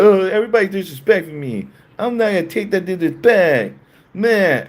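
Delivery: high, fast, mocking complainer; hammed-up offended whine; mocking whiny voice. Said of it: "people are like".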